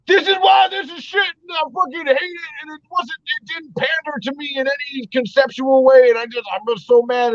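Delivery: parodying a ranting online reviewer, high and whiny; mock angry critic. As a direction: squeal out a petty, furious rant about the show. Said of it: "society of just like".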